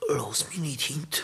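whispered plea, barely voiced; pleading whisper. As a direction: beg him not to leave, in a whisper. Dying man pleads to friend. (geflüstertes Flehen)

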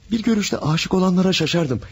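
passionate. Acted. Jose pleading his love.

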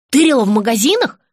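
eager, interested question; suddenly curious; perked up. Acted. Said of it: "оживилась Настя".

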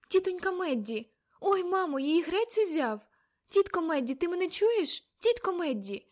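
high, loud, urgent cries; alarmed calling. A narrator's reading. Girl calling to her entranced aunt, alarmed. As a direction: call out in alarm, pitched well up.

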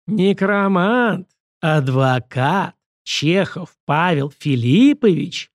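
very slow, each word set apart; syllable by syllable. A narrator's reading. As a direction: spell it out deliberately with heavy pauses. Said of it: "раздельно, почти по слогам проговорил мужчина". (раздельно, почти по слогам)

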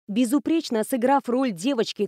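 usual speaking voice

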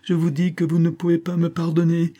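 high-pitched, insistent protest; impatient outburst. A narrator's reading. Sick woman doubts she can be forgiven, impatient outburst. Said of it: "s'écria Rachel Arden avec impatience".

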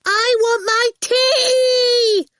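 very high, drawn-out shriek; ear-splitting childish scream; piercing yell. A narrator's reading. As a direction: yell so it cuts through walls. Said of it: "in the piercing way that had earned her name".

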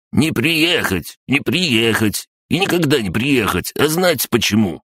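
mock him, drawing out every refusal; насмешливо, высоким голосом; crab-gnome taunting. A narrator's reading.

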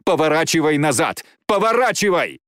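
high, urgent cry climbing; screamed warning. Acted. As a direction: cry out the order desperately. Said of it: "закричала она".

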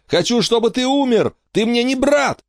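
put-on high, raging child's cry; screaming. A narrator's reading. Furious sister screaming at her brother. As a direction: keep screaming it at him. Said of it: "завопила Эмма на Майкла".

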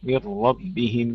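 ربهم drawn out with a thick, full ر; heavy ra. Shown as a demonstration. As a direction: say ربهم slowly, making the ر heavy. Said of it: "وفخم الراء جيدا". (تفخيم الراء في «ربهم»)

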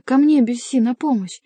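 crying out. A narrator's reading. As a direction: call urgently for rescue. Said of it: "кричала Диана". (крик о помощи)

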